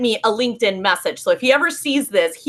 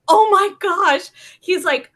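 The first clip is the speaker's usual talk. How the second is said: shocked realization; 9 semitones higher than usual. Retold or imitated. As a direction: act out the man's shock at seeing her bio. Man realizes she's the speaker.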